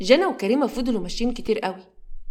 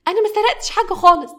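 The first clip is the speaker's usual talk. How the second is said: higher, louder, building to a tearful wail; crying denial; 9 semitones higher, 4 dB louder than usual. Acted. Girl accused of theft by the guard, crying and pleading. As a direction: plead through tears, rising as it breaks. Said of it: "وجنى قعدت تبكي".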